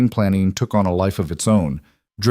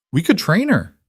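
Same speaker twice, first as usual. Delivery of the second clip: put-on high hopeful child voice; pleading child; 9 semitones higher than usual. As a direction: wheedle like a small girl. Child pleading about the deer.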